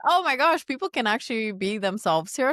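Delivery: high, delighted gasp of discovery; amazed realization. Retold or imitated. Arriving in the U S, delighted high amazement. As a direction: marvel at it like it's brand new. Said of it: "And I was like".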